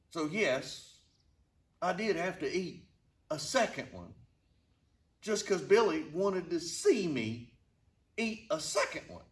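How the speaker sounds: usual speaking voice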